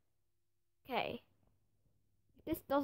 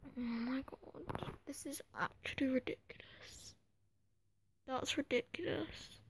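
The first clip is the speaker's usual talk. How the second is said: exasperated whisper; 9 dB quieter than usual. Real, not acted. Gamer stunned by the transfer fee, whispered. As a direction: mutter it under the breath, fed up. Transfer offer fails again.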